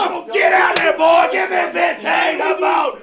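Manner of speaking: screaming drill sergeant orders; barking gym coach. Acted. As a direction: bark it like a boot camp coach.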